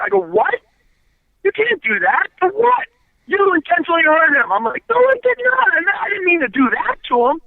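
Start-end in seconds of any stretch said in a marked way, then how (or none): 0.2-4.5 retold outraged protest. Retold quarrel with referee. 4.9-7.4 high-pitched, pleading protest; replaying a desperate denial to the referee; retold frantic denial.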